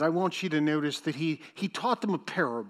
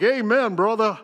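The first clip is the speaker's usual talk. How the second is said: loud, raised-pitch church response; mock amen shout; 5 semitones higher, 8 dB louder than usual. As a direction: play an eager congregant calling back. Mock congregant answering the ad.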